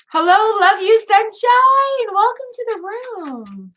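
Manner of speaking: high, slow and sweet hello; sing-song greeting. Real, not acted. Streamer greeting a viewer joining the room, high sing-song welcome. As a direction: croon a welcome to a newcomer.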